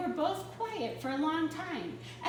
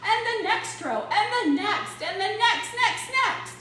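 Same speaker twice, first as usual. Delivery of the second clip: building excitement; 7 semitones higher, 7 dB louder than usual. A narrator's reading. Everyone fainting in rows.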